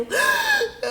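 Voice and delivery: sharp high sobbing gasp; wailing gasp. Acted. Hippo gasping before she wails. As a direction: gasp up into a wail. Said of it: "she would wail".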